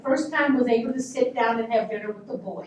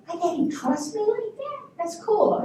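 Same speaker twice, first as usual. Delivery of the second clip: high-pitched, marveling question; retold girlish wonder; amazed; 8 semitones higher than usual. Retold amazement at daddy's trust.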